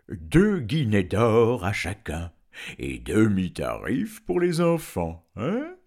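slow, loud dragon voice; haggling dragon. Acted. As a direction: offer it slowly, ending on a high questioning grunt. (dragon qui marchande, voix lente et forte)

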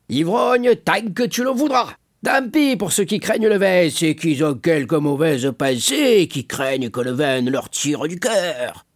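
high-pitched, wine-soaked retort; defiant drunkard. A narrator's reading. Caderousse defending his drinking to Danglars, drunken defiance. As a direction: hold forth like a tipsy drunk.

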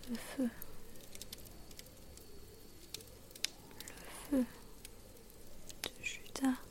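faint, far-off whisper of a dying grandmother; old woman whispering. A narrator's reading. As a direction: breathe it out, barely there, delirious. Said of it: "plus lointaine et sifflante". (murmure lointain et sifflant d'une vieille femme mourante)

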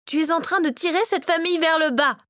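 high-pitched angry reproach; spitting accusation. A narrator's reading. Victoria blames her son for the family's decline, spitting it. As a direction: spit the blame at him. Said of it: "cracha Victoria".

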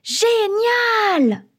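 very high, drawn-out joyful cheer; jumping for joy. A narrator's reading. Children hear the adults say yes, jumping for joy. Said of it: "On saute de joie".

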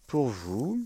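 normal talking voice

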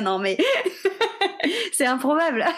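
words broken by laughter; laughing. Real, not acted. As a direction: laugh through the line. Laughing at funny animal characters.

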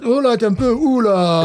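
high, put-on Spanish swagger; mock Spanish exclaiming; imitated Spaniard. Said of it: "t'es les hombre, que tal".

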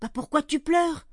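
put-on high, loud, startled child's question; concerned little girl. A narrator's reading. Girl spots Marius crying, high worried voice. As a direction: ask it high and alarmed, as a young girl. Fanny finds Marius in tears.

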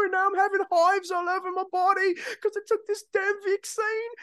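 high, wailing Australian woman; Australian accent. Retold or imitated. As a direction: imitate a distressed Aussie in a high-pitched whine. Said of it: "you do a good job at the Australian accent".

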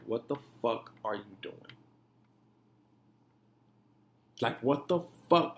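low, hushed stern talk to himself; softly confronting his own reflection; quiet self-confrontation. Retold or imitated. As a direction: question yourself quietly in the mirror. Retold stern talk to himself in mirror.

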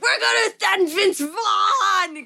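high, drawn-out hammed-up proclamation; mock-grand announcement. Clinic team names who they'll send, mock-grand. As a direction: stretch it out high, like a big reveal.

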